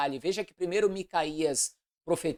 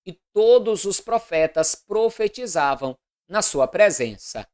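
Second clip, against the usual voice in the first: loud, declamatory Bible reading; formal proclamation of an Old Testament narrative; solemn scripture reading; 7 dB louder than usual. A narrator's reading. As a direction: read scripture aloud with measured, deliberate phrasing.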